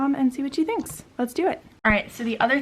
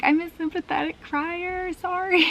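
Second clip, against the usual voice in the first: higher, half-crying apology; retold teary apology; 8 semitones higher than usual. Retelling her speech to the crowd, mock teary. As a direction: imitate herself choking up at the podium. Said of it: "so then I started crying".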